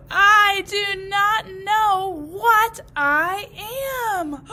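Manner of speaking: loud, slow wail of a lost little grass; slow despairing cry. Acted. Walt telling the grasses, slow loud despair. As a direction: drag it out in distress.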